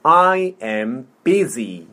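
slow, word by word; slow model. Shown as a demonstration. Teacher modelling "I am busy" for repetition, slowly. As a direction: space out each word for a learner. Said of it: "천천히. 자, 해볼게요".

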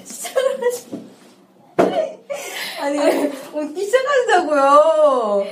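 high, helpless laughter; laughing and tearing up. Real, not acted. Laughing fit during recording.

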